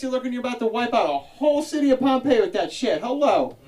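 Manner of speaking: high, loud mock Jersey heckle; blunt Jersey guy. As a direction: bust his chops like a Jersey guy. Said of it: "They're like".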